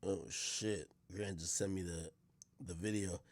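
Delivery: normal talking voice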